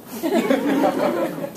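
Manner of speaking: squealing high burst of laughter; high delighted laugh. Real, not acted. Laughing at God's reply.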